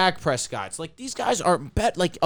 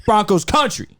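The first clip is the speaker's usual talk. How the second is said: louder hype call; 3 semitones higher than usual. Shown as a demonstration. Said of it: "I'm doing two of them".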